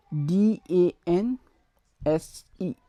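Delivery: usual speaking voice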